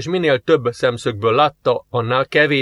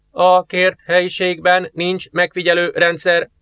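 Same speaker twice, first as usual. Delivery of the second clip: slow, higher machine voice with no feeling; emotionless computer; 6 semitones higher than usual. Acted. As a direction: read it like a cold computer. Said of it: "a számítógép érzelemmentes hangja". (érzelemmentes géphang)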